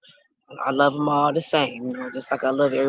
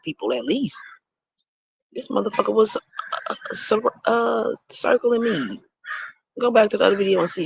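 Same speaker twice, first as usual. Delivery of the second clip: indignant, worked up; 7 semitones higher than usual. Real, not acted. Indignant retelling of being circled.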